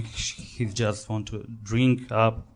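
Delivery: ordinary talk, nothing marked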